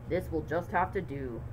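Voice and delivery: low, defeated dad; resigned dad voice. Acted. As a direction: sigh it out like a let-down father. Dad resigned about the tree.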